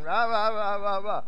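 mock yammering in nonsense syllables; imitated nonstop chatter. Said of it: "she's still talking".

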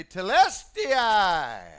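drawn-out ringing cry of Telestai; victory cry. Retold or imitated. Jesus crying out from the cross, drawn-out victory cry. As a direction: Telestai stretched long and called out. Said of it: "he cried loudly".

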